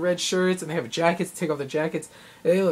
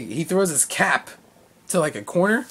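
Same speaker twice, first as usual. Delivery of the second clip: ordinary talk, nothing marked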